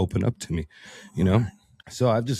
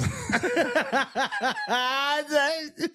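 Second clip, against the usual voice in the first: long high burst of laughter. Real, not acted. Man laughing at himself after quoting John Wayne, long high laugh. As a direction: laugh hard at his own quote.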